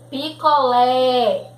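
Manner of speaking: "Picolé" drawn out on an open é; open vowel. Shown as a demonstration. Teacher reading examples to pupils, "Picolé" with long open é. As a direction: stretch and open the final é of "Picolé". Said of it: "é um som aberto".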